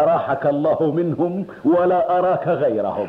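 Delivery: words through laughter; laughing. Acted. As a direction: bless him while laughing. Laughing blessing to host. (يضحك وهو يتكلم)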